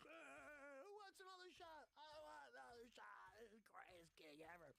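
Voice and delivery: put-on high-pitched, whooping drunken shout; wasted party yell. Young comic rallying the bus for tequila shots, wasted party yell.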